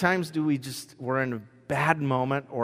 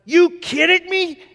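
high, loud, slow cry of disbelief; mock exasperated outburst at the spill; 7 dB louder, slower than usual. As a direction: let it burst out, stretched. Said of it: "I'm just going".

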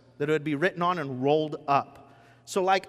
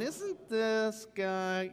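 slow puzzled murmur; 4 semitones higher, 7 dB quieter, much slower than usual. Retold or imitated. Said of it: "some going".

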